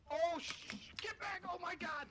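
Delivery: panicked yelling, high and loud; scared horror game streamer. Real, not acted. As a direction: yell in real fright as it chases you. Chased by a creature in game.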